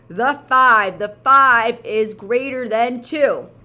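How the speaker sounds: loud, slow winner call; triumphant announcement. Pretend. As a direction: crown the winner proudly.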